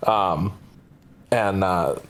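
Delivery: ordinary talk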